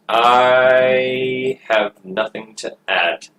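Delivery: slow, lingering start on "I"; drawn-out delivery. Acted. Player stalling on having nothing to add, drawn out. Repeats line, first drawn out.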